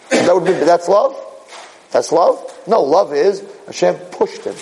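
normal talking voice

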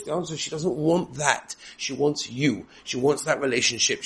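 ordinary delivery

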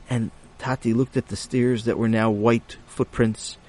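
plain reading voice

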